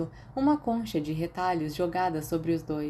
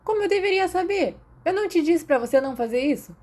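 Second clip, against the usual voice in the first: much higher, louder exasperated scold; exasperated Grant; 11 semitones higher, 5 dB louder, faster than usual. Acted. Grant scolding Remus for the kiss, high and exasperated. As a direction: throw up your hands and scold him.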